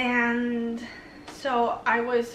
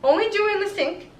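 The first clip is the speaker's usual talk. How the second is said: firm self-rule; 10 semitones higher, 7 dB louder, faster than usual. Real, not acted. Stating her own new rule.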